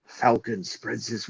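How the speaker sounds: lightly voiced Attenborough documentary narrator; Attenborough narration. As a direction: imitate a hushed British wildlife documentary narrator. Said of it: "you wanna hear David Attenborough".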